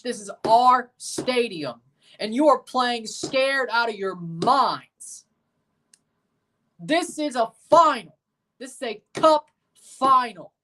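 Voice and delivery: fired-up coach team talk; halftime pep speech. Pretend. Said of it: "If I was Oscar Pareja, now this is a team talk".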